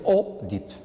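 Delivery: Oedipe with a plain "o" at the start; mispronounced Oedipe. Shown as a demonstration. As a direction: say Oedipe as "o-dipe", questioning. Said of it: "on ne dit pas Oedipe".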